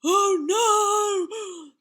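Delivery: loud, high giant's wail; sobbing wail. A narrator's reading. Beaten giant loses the underpants, sobbing and wailing. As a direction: wail it out like a big baby. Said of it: "the giant sobbed and wailed".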